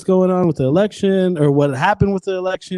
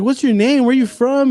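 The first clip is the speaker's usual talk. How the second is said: higher-pitched mock-interested questions; sarcastic interviewer; 8 semitones higher than usual. Sarcastic guy interviewing a crying lady, mock interest. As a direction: imitate feigned interest. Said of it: "this sarcastic guy".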